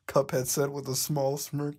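ordinary delivery

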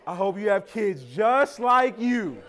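mama's slow, amused curse. Retold or imitated. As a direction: curse the child knowingly, slowly. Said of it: "your mama come up on you and she says".

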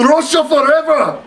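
high, hammed-up Russian accent; terrible Russian accent. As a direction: proclaim it in a badly faked Russian accent. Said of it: "That was a terrible accent".

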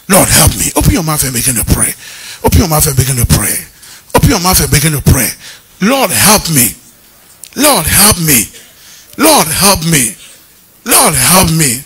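low, loud, chanted "Lord, help me"; leading prayer. Acted. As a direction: lead the congregation into prayer, firm and repeated. Leads congregation in prayer.

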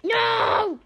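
high, loud drawn-out protest; dismayed yell. Real, not acted. Player thwarted in game, high dismayed yell. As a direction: wail "no" at the setback.

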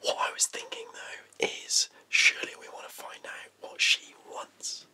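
breath-only, secretive whisper. Acted. Man argues for meeting his ex, whispering. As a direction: keep whispering as you reason with him. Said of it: "why am I whispering?".

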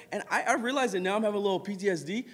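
normal talking voice

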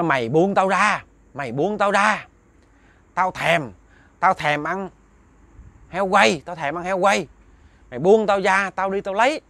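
high, insistent imitation of a possessed voice; possessing spirit, hoarse, middle-aged. Spirit in Đức demanding release and roast pig, hoarse and insistent. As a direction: act out a ghost craving food, voice pushed up. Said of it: "giọng lơ lớ của một cái người, lớn tuổi mà kiểu mà trung niên".

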